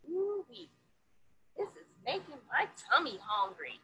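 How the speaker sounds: lively picture-book read-aloud; reading to children. A narrator's reading. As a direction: read it to a classroom of kids, bright and sing-song.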